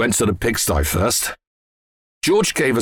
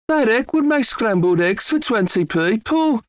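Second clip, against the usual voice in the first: high-pitched amazed woman; impressed farmer's wife, voice lifted; mother character; 12 semitones higher than usual. A narrator's reading. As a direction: remark in a high, put-on woman's voice. Mother's line in story.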